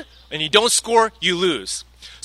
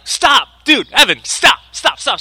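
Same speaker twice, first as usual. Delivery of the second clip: higher, louder urgent mock calling out; retold urgent call to stop the son; half-played dad stopping a son from eating; mock alarmed stop; 6 semitones higher, 4 dB louder than usual. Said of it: "And I'm like".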